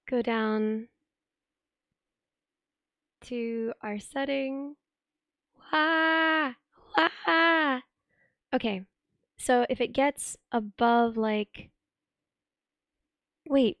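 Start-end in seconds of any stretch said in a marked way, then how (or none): 5.7-7.8 short sharp yell into mic; loud test shout. Real, not acted. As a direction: belt out a quick wah. Testing loudness again.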